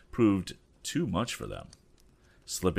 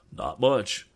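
cried out; 5 semitones higher, 4 dB louder than usual. Acted. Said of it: "cried Pete".

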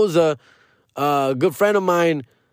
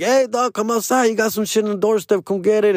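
higher, calling from the doorstep; 6 semitones higher than usual. Retold or imitated. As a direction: shout it up toward the door.

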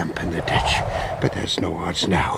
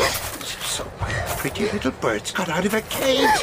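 leering villain; 12 semitones higher than usual. Acted. Villain corners Mary in dark.

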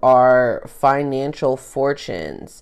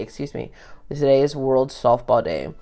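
normal talking voice

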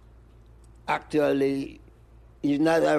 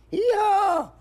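squeaky falsetto hee-haw; a tiny donkey braying high and loud; 4 dB louder than usual. Pretend. Mimicking a donkey for the interviewer in falsetto. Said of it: "says the donkey".

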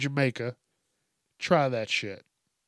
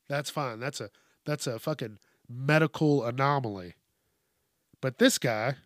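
normal talking voice

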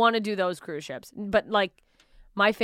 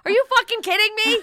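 much higher, fast exasperated squeal; mock outrage; 13 semitones higher, 6 dB louder, faster than usual. Comic acting out her exasperation at her family, high squeal. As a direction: squeal it in disbelief. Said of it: "And it's like".

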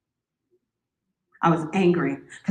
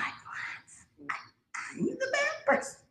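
hushed, stunned 'what, I'm the bad person'; playing her own baffled self; mock disbelief; 15 dB quieter, much slower than usual. To the boyfriend, incredulous hushed disbelief. Retelling her confused reaction.

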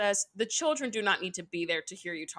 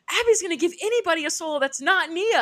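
mock angry; 8 semitones higher, 6 dB louder, faster than usual. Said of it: "And she's like".

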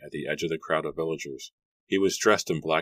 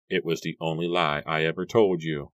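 plain reading voice

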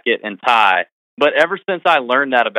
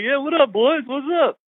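imitated buddy greeting. Guest greeting the podcast boys, high imitated chumminess. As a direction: mimic a pal's breezy hello, pitched up.

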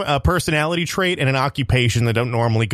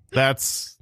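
usual speaking voice

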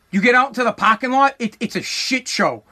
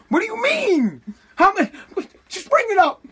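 much higher, pleading disbelief; exasperated pleading; 10 semitones higher than usual. Retold or imitated. Begging the lady to just bring it up, pitched way up. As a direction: squeak it out, at your wits' end.

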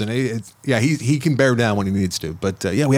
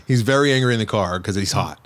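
ordinary delivery

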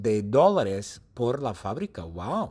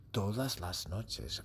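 "todas las noches" slow and much softer; hushed; 5 semitones lower, 13 dB quieter, slower than usual. Shown as a demonstration. Teacher hushes the class for the night scene, softly. Repeated phrase hushed for suspense.

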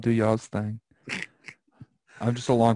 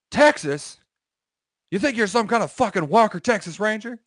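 higher, mocking shout; drill sergeant yell; 8 semitones higher, 4 dB louder than usual. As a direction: bark it like a drill instructor. Retold drill instructor yelling.

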